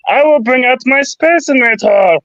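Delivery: high cartoon alien voice; lightly voiced little Martian; Marvin the Martian voice. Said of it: "pretty good of a Marvin the Martian voice".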